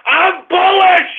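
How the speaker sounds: high, slow "I'm bullish"; a host hyping the stock market; excited shout. Acted. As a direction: proclaim it loud and proud. Proclaiming his market view.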